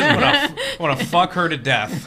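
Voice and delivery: high laugh then words through giggles; real laughter. Real, not acted. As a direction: giggle out the answer. Guest laughs through his answer.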